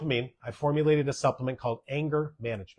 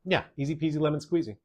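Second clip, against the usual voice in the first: ordinary talk, nothing marked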